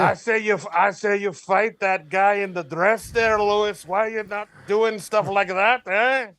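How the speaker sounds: put-on goading in a comic Irish accent; Conor McGregor. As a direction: needle your co-hosts in stage Irish. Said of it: "Do we have Connor on the line".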